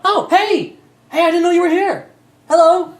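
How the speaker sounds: high, loud, overjoyed hello; cheery high NPC calling down; excited NPC greeting. Acted. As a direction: greet them like a long-lost friend.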